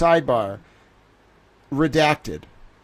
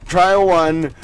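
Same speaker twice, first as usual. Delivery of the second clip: ordinary delivery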